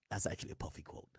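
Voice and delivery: unvoiced aside to save the voice; laughing, whispered aside. Real, not acted. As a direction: whisper it because the voice is gone. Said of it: "if I'm whispering, it's because voice".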